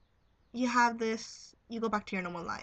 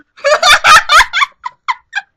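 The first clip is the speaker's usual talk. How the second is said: high, loud burst of laughter; 25 dB louder than usual. Real, not acted. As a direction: crack up loudly. Laughs after naming friend.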